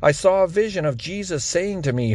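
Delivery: plain reading voice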